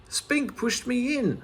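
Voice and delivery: high, slow whine from the egg box; Medal whining. A narrator's reading. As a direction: plead your innocence. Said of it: "said Medal".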